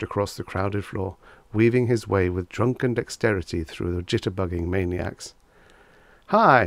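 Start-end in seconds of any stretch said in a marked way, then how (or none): 6.3-6.6 high, loud glad greeting; glad cry. A narrator's reading. Drunk Reggie spots a friend across the bar, glad loud cry. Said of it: "he cried".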